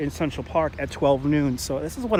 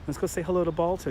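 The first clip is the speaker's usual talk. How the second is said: usual speaking voice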